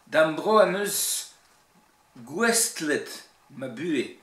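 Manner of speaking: Breton verse with strong Cornouaille stresses; emphatic Cornouaille Breton recitation; Cornouaille Breton accent. Shown as a demonstration. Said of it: "l'accent cornouaillais".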